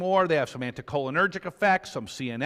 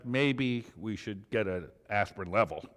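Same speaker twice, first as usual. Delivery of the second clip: deadpan; 8 semitones lower, 5 dB quieter than usual. Retold or imitated. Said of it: "we said to the resident".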